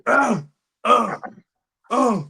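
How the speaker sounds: usual speaking voice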